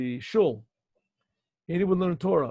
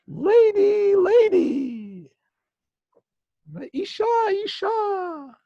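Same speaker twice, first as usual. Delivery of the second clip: fake angel voice; 6 dB louder, much slower than usual. Boy hides, fakes an angel voice.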